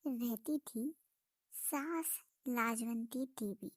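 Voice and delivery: soft, even storyteller voice; story narration. A narrator's reading. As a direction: tell it like a village tale to listeners. Narrates the village tale.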